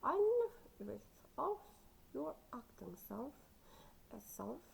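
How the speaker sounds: playful, shaky attempt at an Irish accent; badly faked Irish lilt. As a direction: try on an Irish accent, badly, with exaggerated swoops. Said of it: "I can't do that very well, the accent".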